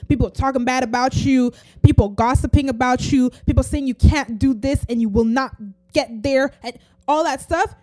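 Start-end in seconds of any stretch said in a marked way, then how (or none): none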